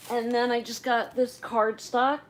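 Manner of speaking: ordinary talk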